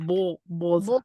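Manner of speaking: mock Russian accent without the R; terrible Russian accent. Shown as a demonstration. Reader defending the pronunciation, terrible Russian accent. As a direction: try the name in a bad Russian accent. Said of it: "My terrible Russian accent".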